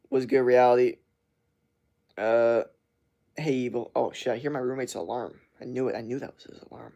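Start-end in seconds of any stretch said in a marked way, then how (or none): none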